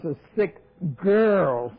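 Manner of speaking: slow, loud mock verdict; exaggerated, drawn-out imitation; mocking quote. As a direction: draw out the last word loudly. Mimics the man's verdict on her.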